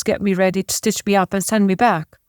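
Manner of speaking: ordinary delivery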